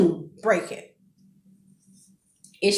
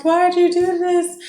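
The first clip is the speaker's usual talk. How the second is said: upset pleading; 10 semitones higher, 3 dB louder than usual. Retold or imitated. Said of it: "starting to feel guilty".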